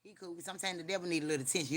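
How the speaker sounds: ordinary talk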